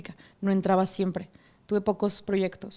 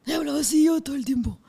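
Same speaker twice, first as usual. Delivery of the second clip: voz aguardientosa; 6 semitones higher than usual. Shown as a demonstration. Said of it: "mi voz aguardientosa empezó a parecer [name]".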